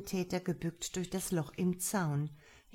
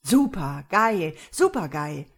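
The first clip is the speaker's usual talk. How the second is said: louder, overexcited cheering; can't calm down from the thrill; 10 dB louder than usual. A narrator's reading. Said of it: "konnte sich gar nicht beruhigen".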